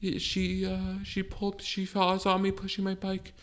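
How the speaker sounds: high, soft, halting account; imitated nervous teen. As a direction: meekly explain yourself, like a scared kid. Acting nervous teen before judge.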